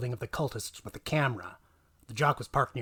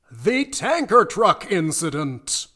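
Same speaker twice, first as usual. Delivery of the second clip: slow, booming trailer-voice title, higher and louder; dramatic announcement; 6 semitones higher, 9 dB louder, slower than usual. Acted. Narrator introducing the next chapter as a booming trailer title.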